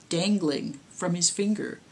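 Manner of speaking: ordinary delivery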